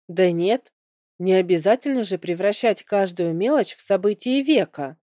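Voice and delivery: usual speaking voice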